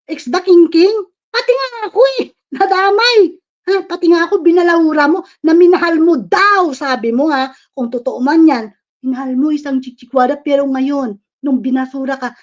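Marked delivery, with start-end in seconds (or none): 0.1-6.7 loud, higher, indignant shouting; outraged yelling. Real, not acted. Yelling at him for dragging her in too, outraged. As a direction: howl with outrage at being dragged in.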